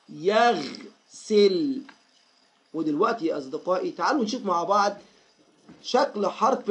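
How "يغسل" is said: يغسل drawn out in two parts, stopping on the غ; slow teaching demo of يغسل with a held vowelless غ; halting on the sukun. Shown as a demonstration. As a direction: say يغسل very slowly, halting after يغ. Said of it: "السكون يا أصدقائي معناها الوقوف".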